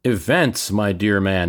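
ordinary delivery

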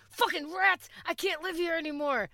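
mock panicked outburst. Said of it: "I'm like".

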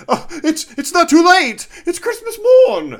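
put-on giddy Victorian call to a boy in the street; Scrooge on Christmas morning. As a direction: shout out the window like a reborn Scrooge. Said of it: "head out the window and go".

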